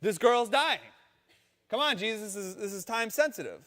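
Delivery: a frantic father hurrying Jesus along; desperate father. Retold or imitated. Jairus pleading with Jesus.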